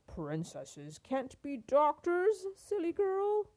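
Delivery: loud, slow royal put-down; haughty storybook king dismissing her; scornful king. A narrator's reading. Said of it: "The king replied".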